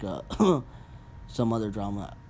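ordinary talk

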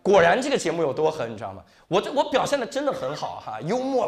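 ordinary delivery

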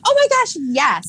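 very high-pitched delighted yes; excited. Real, not acted. Co-host agreeing to the women's history segment, excited. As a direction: squeal your agreement. Thrilled at being asked.

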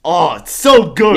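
high, loud, slow rapture; thrilled cry of delight; gushing. Real, not acted. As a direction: savor every word like a favorite dish. Gushing about the show.

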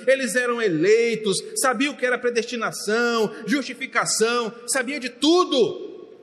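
high, forceful pulpit voice; pregação inflamada. Real, not acted. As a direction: preach it hard, each phrase a new push. Preaches at full force. (pregação exaltada, em voz alta e aguda)

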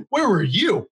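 exasperated reproach. Retold or imitated. His exasperated reproach to bystanders.

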